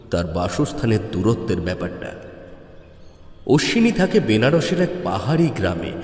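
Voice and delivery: usual speaking voice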